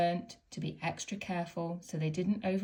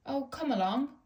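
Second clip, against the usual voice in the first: mild impatient puff; 7 semitones higher than usual. A narrator's reading. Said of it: "he puffed impatiently".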